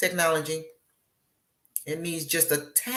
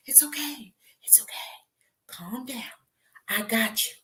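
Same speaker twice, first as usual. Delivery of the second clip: soft, higher reassuring hush; calm the panicked viewer gently; soothing; 4 semitones higher, 5 dB quieter than usual. Acted.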